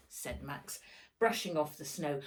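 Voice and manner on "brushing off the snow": usual speaking voice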